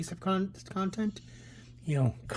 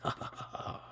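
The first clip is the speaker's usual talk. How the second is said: soft, staged villain chuckle; gloating villain laugh; 11 dB quieter than usual. Acted. Escaped criminal gloating over island death, acted laugh. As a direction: trail off in a low-volume acted cackle.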